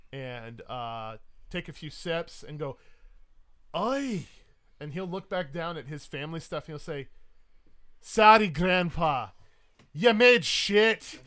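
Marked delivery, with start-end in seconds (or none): 3.8-4.3 high, loud Scottish exclamation; Highland Scot. Acted. Highland Scot reacts to rum can, loud exclamation. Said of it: "this world's equivalent of a Highland Scot". 8.0-11.2 very loud, slow Scottish verdict; booming brogue, stretching each word; Highland Scot. Acted. Scot looks at his family brew, loud Highland verdict. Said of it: "this world's equivalent of a Highland Scot".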